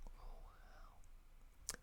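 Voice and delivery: ordinary talk, nothing marked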